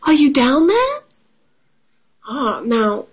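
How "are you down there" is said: high rising question call; pitched-up searching call; pig calling out. Acted. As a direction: call down a well as a storybook pig. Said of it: "said pig".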